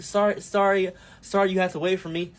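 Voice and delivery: nervously over-apologize; apologetic over-sorry. Shown as a demonstration. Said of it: "stop saying sorry".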